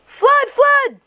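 very high, loud false alarm; high loud fake alarm call; alarmed shout. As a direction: yell fire to scare the birds. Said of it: "he cried".

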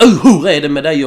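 put-on a loud friendly shout from an old classmate; loud hollering greeting. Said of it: "och hojtade till".

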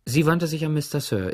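ordinary delivery, nothing marked

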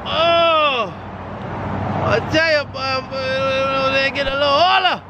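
mock complaining. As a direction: whine about getting old. Joking old man complaint.

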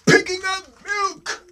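very high, drawn-out call; mock-dramatic announcement. A narrator's reading. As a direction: announce the challenge in a high cry.

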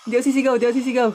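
high, loud, very fast repetition; panicky self-restraint. Real, not acted. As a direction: hurriedly talk yourself out of screaming. Holding back a scream while scared. (natataranta, mataas at mabilis)

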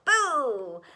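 loud drawn-out boo; storyteller's ghostly shout; spooky shout. A narrator's reading. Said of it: "where the spooks shout".

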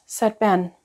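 ordinary delivery

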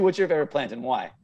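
ordinary delivery

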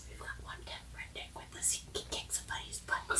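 hushed whisper in the dark. Real, not acted. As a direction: keep it to a breathy whisper. Hushed talk in dark basement.

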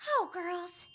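slow, dreamy gush rising high; swooning. Acted. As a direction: sigh over a crush to your friends. Swooning over the dancer.

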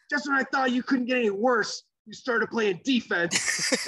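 high mock heckle from the stands; heckling fan. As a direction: imitate a kid taunting a player. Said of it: "He's hanging over the glass".

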